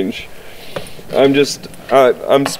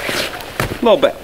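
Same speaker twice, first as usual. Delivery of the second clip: soft coaxing; 5 semitones higher, 4 dB quieter, slower than usual. Acted. Coaxing dog softly.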